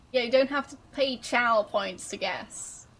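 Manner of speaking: normal talking voice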